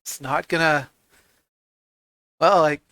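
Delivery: lightly voiced gruff constable; impatient boss hurrying them in. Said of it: "Stanton goes".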